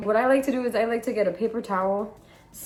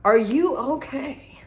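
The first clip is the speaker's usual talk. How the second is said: higher, louder, slow caring; concerned reassurance; 6 semitones higher, 7 dB louder, slower than usual. Retold or imitated. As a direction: comfort her gently but urgently. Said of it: "I was like".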